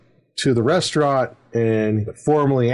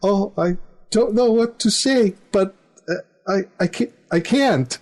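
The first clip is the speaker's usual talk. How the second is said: higher, coy daughter voice hesitating; hesitant daughter; 8 semitones higher than usual. Retold or imitated. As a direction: play the shy daughter who can't say yes. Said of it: "Phoenix is acting".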